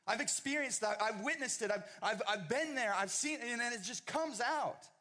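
high, loud, fired-up preacher shouting; preaching at full force. Acted. Pastor shouting to church about unstoppable witness, at full force. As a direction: preach it, voice ringing over the congregation.

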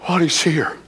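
breathy worship whisper. Real, not acted. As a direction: hush it reverently. Worship moment.

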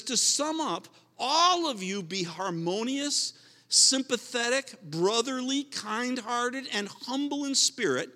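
scripture reading. Real, not acted. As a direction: lift the verse up like a proclamation. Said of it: "He says".

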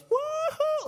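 high whooping cry of joy; imitating a thrilled scream. Preacher imitating Zac Efron's whoop while heeling, a high joyful scream. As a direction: whoop like a sailor tearing across the lake. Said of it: "he screams 'cause he's just having a blast".

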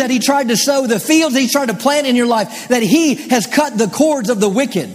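high-pitched, impassioned pulpit delivery; fervent preaching. Real, not acted. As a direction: preach it with rising intensity. Preaches at full force.

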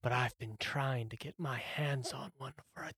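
very soft, breath-only golem voice; raspy whisper. Acted. Golem answering the player's question about dragons, raspy whisper. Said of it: "the one that's been talking to you".

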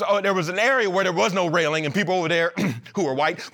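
normal talking voice

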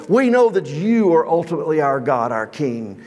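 ordinary talk, nothing marked

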